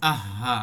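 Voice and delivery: আহা with the হ held for one alif length; আহা drawn out evenly. Shown as a demonstration. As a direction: stretch the breathy হ in আহা. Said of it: "এই যে ধরে রাখাটা".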